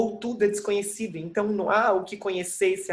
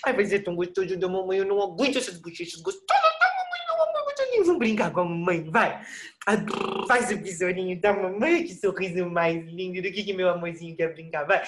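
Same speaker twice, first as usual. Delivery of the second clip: put-on doting baby talk. Mother cooing at her baby son, doting baby talk. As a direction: coo at the baby to coax him into playing.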